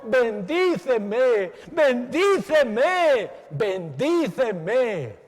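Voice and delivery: slow, loud pleading cry of "Bendíceme"; crying out pleadingly. Acted. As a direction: drag out each "Bendíceme" like a desperate plea. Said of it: "Lloraba, gemía, clamaba, diciendo". (Jacob clamando lento y fuerte al ángel)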